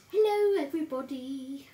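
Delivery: hammed-up sing-out hello to everyone; imitating mother. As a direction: burst in like a mum crashing the stream. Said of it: "She'll be wanting to come in and go".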